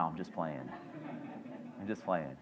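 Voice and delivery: soft, low teasing take-back; a quiet mock-sheepish retreat; just kidding. Real, not acted. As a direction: drop the voice to walk back the joke. Teasing aside about Mike.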